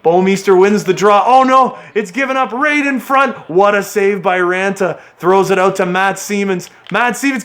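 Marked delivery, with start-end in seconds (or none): 1.3-3.3 alarmed. Real, not acted. A chance given up in front.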